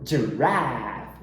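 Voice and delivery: giraffe with the American ash vowel; low American giraffe, front A; American accent. Shown as a demonstration.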